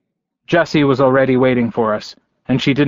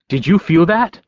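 higher-pitched, rising question; mildly urgent, raised-pitch demand; 5 semitones higher than usual. A narrator's reading. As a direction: demand an answer as you rush in. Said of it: "he demanded as he came".

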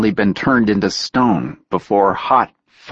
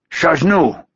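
foreign guard calling out; called out; 9 semitones higher, 3 dB louder than usual. A narrator's reading. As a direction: call out the challenge. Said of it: "the man called out".

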